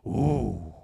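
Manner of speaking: low mock dog groan, ooh; low grumbling ooh like a dog; dog's low grumble. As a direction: imitate a grumbling dog holding back a bark. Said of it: "Gentry, used to go".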